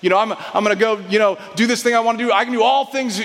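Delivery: cheerleader-style overconfidence; hammed-up go-getter pep; peppy inspirational. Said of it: "it's kind of a peppy, inspirational".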